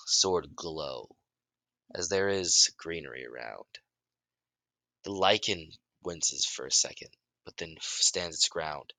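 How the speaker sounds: ordinary delivery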